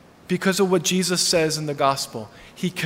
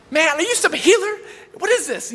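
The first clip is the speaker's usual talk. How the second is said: imitating an astonished woman, much higher; 10 semitones higher than usual. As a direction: pitch it way up in disbelief.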